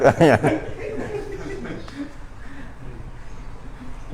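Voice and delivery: a real drawn-out laugh, high-pitched; laughing hard at his own story; long high laugh. Real, not acted. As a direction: laugh freely at the punchline. Laughing after the joke.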